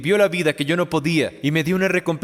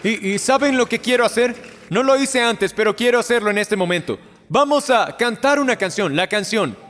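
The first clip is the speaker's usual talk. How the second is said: fervent altar call; 5 semitones higher than usual. Acted. Pastor urging people from the balcony to come forward, excited and urgent.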